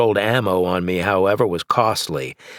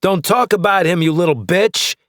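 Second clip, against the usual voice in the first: loud, higher furious threat; angry; 9 semitones higher, 4 dB louder than usual. Acted. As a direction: spit it out in rage. Enraged enemy after taunt.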